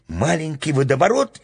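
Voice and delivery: plain reading voice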